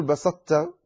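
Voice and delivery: quick "بسطت", light t with no heaviness; the wrong "بسطت", ṭāʾ gone; wrong, ṭāʾ lost. Shown as a demonstration. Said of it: "ولا تقل".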